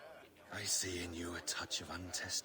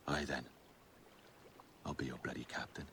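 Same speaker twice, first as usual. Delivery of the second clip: ordinary talk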